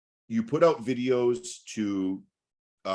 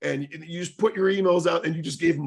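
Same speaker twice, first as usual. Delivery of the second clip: retold angry rant; 9 semitones higher than usual. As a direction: tear into him, pitched way up. Retelling friend's angry outburst.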